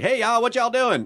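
mock a stock Southern guy with a quick y'all; generic Southern accent. Southern accent as an actor's generalized Southern guy. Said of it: "quote, Southern guy and you were like".

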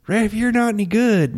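high-pitched mock yell at the ref; mock heckle. Co-host imitates fans heckling the ref, mock yelling. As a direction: imitate a fan screaming from the stands. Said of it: "the screaming at the ref".

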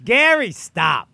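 mock yell.

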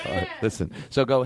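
ordinary delivery

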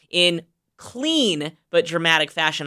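"clean" drawn out slowly with a pitch swell; stress on clean. Real, not acted. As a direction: set "clean" apart and stretch it. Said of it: "I emphasize the word clean".

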